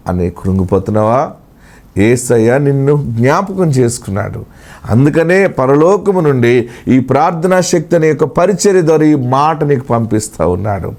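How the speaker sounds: hushed prophetic consolation; prophetic word. Acted. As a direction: speak for God, low and close. God speaking to the listener.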